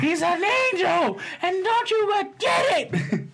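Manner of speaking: hammed-up mom defending her angel son; shrill mom. Mother scolding her other son, very high and slow.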